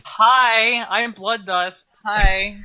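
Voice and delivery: loud, high, bubbly hello; big eager hi to the crowd; excited greeting. Real, not acted. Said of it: "Say hello to the people".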